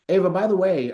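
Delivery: ordinary talk, nothing marked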